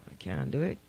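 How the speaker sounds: ordinary delivery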